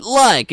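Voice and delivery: "like" said high and stretched; mock the filler "like" loudly; exaggerated "like". Shown as a demonstration. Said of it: "it's gonna be like this".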